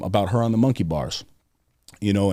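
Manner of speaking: ordinary talk, nothing marked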